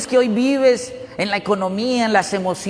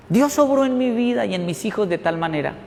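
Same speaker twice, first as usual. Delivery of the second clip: normal talking voice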